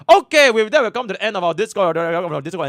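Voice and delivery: loud, high showman announcement; hype announcer. Real, not acted. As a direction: announce it like a host. Streamer wraps up stream, hyped.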